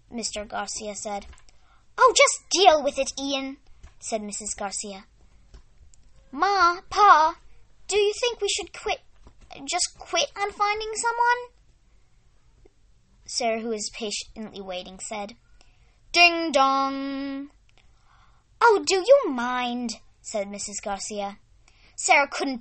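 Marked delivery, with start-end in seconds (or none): 2.0-3.6 loud, sharp and fed up; irritated snap. Acted. Wife snaps at husband. 6.3-11.5 put-on high pleading little girl; child voice. 16.1-17.5 sung ding dong chime; doorbell chime. Acted. Doorbell imitated. 18.6-20.0 slow annoyed. Acted. Mother annoyed at interruption.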